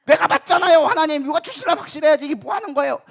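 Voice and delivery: high, loud, acted indignation; worked-up protest at God; angry outburst. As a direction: argue heatedly as if wronged. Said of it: "막 화내기 시작하는 거예요".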